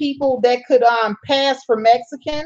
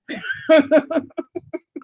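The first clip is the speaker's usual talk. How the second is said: a real drawn-out laugh; long playful chuckle; teasing laugh; 5 semitones higher than usual. Real, not acted. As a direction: laugh off the joke. Teasing viewer about being high yellow.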